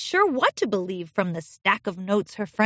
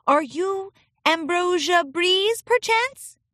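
high, slow questioning lilt; kindly princess; 9 semitones higher, slower than usual. Acted. Princess addressing a filly from the seating chart, kindly. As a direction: ask it sweetly, rising high at the end.

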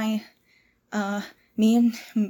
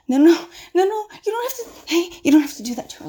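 alarmed protest; 4 semitones higher, 6 dB louder, much faster than usual. Acted. Frantic protest to protect captive.

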